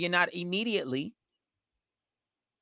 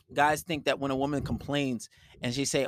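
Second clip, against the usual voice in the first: ordinary talk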